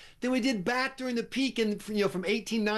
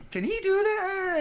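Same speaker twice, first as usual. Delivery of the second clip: high, slow worried question; hesitant, hammed-up disbelief; mock alarmed public; 12 semitones higher than usual. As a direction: imitate bewildered onlookers, pitched way up. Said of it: "And people are like".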